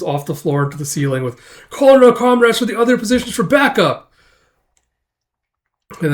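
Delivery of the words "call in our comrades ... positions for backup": high, loud mock call for help; urgent call. Guard summoning comrades for backup, urgent call.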